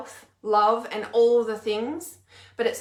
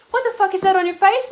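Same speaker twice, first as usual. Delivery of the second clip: high, loud, fast jeering stranger; mock rude stranger; 9 semitones higher, 9 dB louder, faster than usual. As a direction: toss the insult back at them. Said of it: "Would you walk up to me and say".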